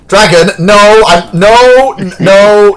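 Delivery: loud, high repeated no; firm loud protest; loud refusal. Real, not acted.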